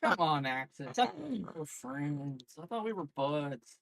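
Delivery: low mock hurt voice. Teasing viewer in low voice.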